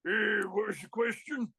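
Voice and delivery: put-on biker buddy voice; Pitbull. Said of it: "that was Pitbull's favorite saying".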